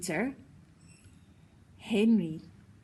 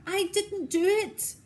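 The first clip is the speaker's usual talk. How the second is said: Peter protesting; 10 semitones higher, 4 dB louder than usual. A narrator's reading. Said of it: "said Peter".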